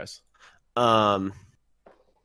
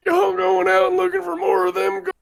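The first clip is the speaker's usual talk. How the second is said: put-on very old man; 8 dB louder than usual. Old man voiced in play.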